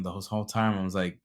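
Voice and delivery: ordinary delivery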